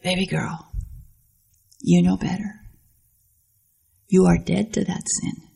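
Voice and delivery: Jesus gently correcting a daughter, tender; gentle Jesus. Pretend. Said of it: "Jesus might say to you".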